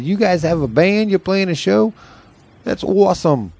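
high, excited imitated Boston guys; excited reply. Retold excited Boston musicians.

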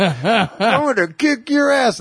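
high mock drunken threat. Drunk guy threatening the kids, high hammed-up threat. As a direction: ham up the angry drunk. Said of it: "he's like".

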